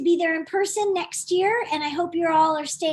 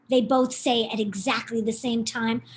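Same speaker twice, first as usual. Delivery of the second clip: ordinary delivery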